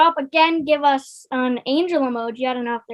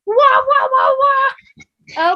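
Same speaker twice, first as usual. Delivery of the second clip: high party noise; 9 semitones higher, 5 dB louder, much slower than usual. Pretend. Party celebration effect voiced.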